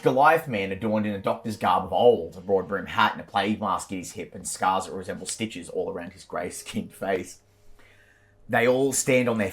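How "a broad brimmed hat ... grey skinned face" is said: ordinary talk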